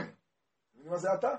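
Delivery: normal talking voice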